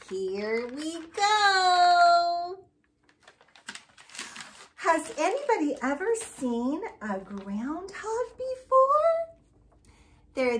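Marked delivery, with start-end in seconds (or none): 0.1-2.6 high, slow and loud puppet voice; drawn-out excited puppet. Acted. Groundhog puppet starts. 8.4-9.4 high, loud squeal on the question; high, loud swooping ask; big rising question. Acted.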